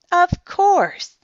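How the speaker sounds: high, loud, obliging hostess; polite reply. A narrator's reading. Olivia to guests wanting rooms, polite reply. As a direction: swoop up eagerly to oblige. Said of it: "Olivia replied politely".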